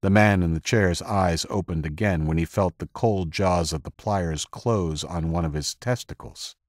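ordinary delivery, nothing marked

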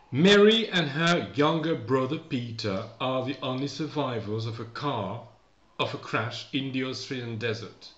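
slow, deliberate English reading; read-aloud for learners. Acted. Teacher reads the story summary to learners, measured read-aloud. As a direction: read it out slowly, carefully spacing each phrase.

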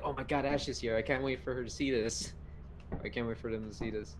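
a hushed, giddy whisper of excitement; quietly gleeful, barely able to wait. Retold or imitated. Friend retelling her hushed glee before the prank.

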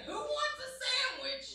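high-pitched, hammed-up angry holler; mad-sounding dad. Texan dad offering cookies and love, mock-mad shouting. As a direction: yell kind words as if furious. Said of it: "it sounds mad".

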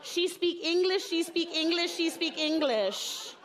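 high, rhythmic repeated phrase; insistent repeating mother. Acted. Mother insisting to teacher about daughter, acted insistence. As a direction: drive it home, high and chant-like. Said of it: "She just repeats phrases".

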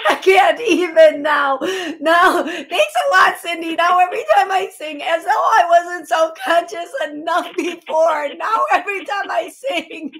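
high, loud, laughing through the words; nervous laughter. Real, not acted. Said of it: "I'm laughing 'cause I'm hella nervous".

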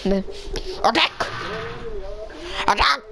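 flat, soft robotic call. Shown as a demonstration. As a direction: try for a robot voice. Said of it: "I'm trying to do a robotic voice".

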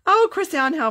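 high, loud delighted cry of a name; light up at a viewer's name; excited greeting. Real, not acted. Host greeting viewer Chris Ann with a high, loud delighted cry.

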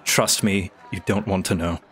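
low, restrained character voice; grave warning. Acted. Cena warns friend with hand on shoulder, grave. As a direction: advise them low and evenly.

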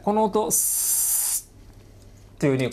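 スー drawn out as a long voiceless s; hissing s sound. Shown as a demonstration. Teacher showing students the s sound, slow hiss.

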